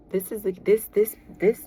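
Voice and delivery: tripping over the words; stumbling stutter, restarting. Real, not acted. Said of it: "I'm stuttering".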